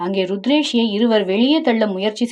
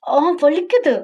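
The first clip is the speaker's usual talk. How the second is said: mock pain yelp; 6 semitones higher than usual. He bites her cheek.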